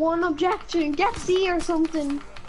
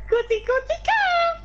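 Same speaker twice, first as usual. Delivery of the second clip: high calling voice; 3 dB louder than usual. Acted. Calling a character in.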